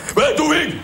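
appel très aigu et fort, en riant; imitation d'Alain. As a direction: crie après le chien comme Alain, voix perchée. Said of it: "j'adore l'imiter". (imitation d'Alain qui appelle Toufik, très aigu)

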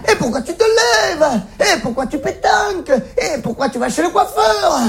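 high-pitched pestering questions; mock insistent questioning. Philosophy pesters you with questions on waking, high insistent cries. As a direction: fire off each why in comic exasperation.

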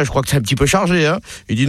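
ordinary talk, nothing marked

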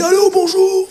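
mock gruff voice, but it pops up high; trying to sound manly but failing; fake big voice. Caller faking a big voice on the phone, comic strained gruff. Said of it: "prendre une grosse voix au téléphone".

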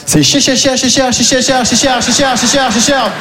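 machine-gun chain of "cher", evenly repeated; rapid stutter-like repetition. Acted.

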